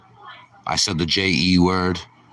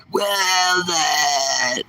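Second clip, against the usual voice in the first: very long, high wailing call; anguished movie yell; 7 semitones higher than usual. Acted. Repeating the Tom Hanks bit, hammed-up high yell. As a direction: stretch the name out in a falling high cry.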